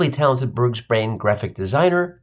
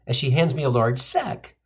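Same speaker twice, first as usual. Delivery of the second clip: ordinary delivery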